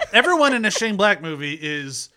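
loud laugh through the words. Real, not acted. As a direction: laugh while talking. Laughing at own joke.

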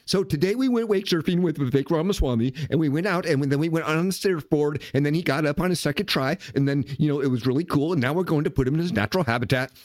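mock the breathless influencer narrating a video; mocking influencers' quick chopped voice. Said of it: "this real quick chopped voice".

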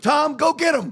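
high yelled ringside call; shouting coach. Retold or imitated. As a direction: yell it from the corner. Corner coach yelling at fighter.

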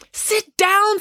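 high, forceful command; exasperated driver's high-pitched order; angry order. A narrator's reading. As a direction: command it crossly. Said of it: "said Mister Lang".

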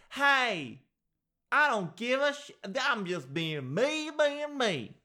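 very high, hammed-up defiance; mock brazen holler. Someone brazenly greets Obama, mock defiant holler.

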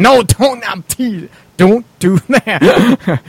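high, loud, laughing plea; joking protest. Pretend. Host taking back a Xanax joke to the guys, laughing protest. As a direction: backpedal loudly through laughter.

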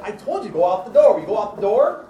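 put-on high, loud angry snap at a child; mother snapping. Mother snapping at her son at the store door, loud. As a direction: bark it at the kid, fed up. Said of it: "she snapped at him".

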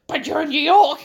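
hammed-up tourist-mad insistence; mock incredulous protest. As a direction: protest as if it's unthinkable. Acted protest by obsessed receptionist.

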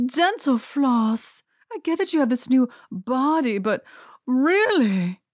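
high, slow British drawl, appalled; mock-posh British disapproval, drawn out; hammed-up British lady, high and slow; British accent. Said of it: "I should do her British accent".

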